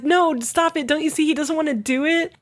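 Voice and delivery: a mild pleading cry; pleading girl. Retold or imitated. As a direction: beg him to stop, voice lifted. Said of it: "she's like".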